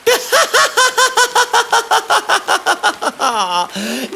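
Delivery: high villain laugh; mocking laugh. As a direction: laugh him off, scornfully. Pharaoh mocks Moses.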